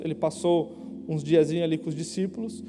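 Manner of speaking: ordinary delivery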